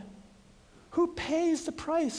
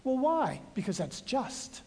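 plain reading voice

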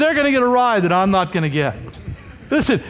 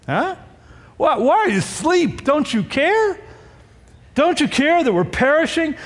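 higher-pitched, urgent questioning; mild mock desperation, pitched up; acted pleading; 7 semitones higher than usual. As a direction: plead with someone who seems not to care. Voices disciples pleading with sleeping Jesus.

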